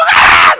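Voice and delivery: loud high "Ah!" scream; a sudden scream to spook someone; startling yell. Acted. Demonstrates startling boy with toy.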